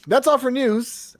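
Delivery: stretched slow sign-off; announcer sign-off. Acted. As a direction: wrap up like a show host. Segment sign-off.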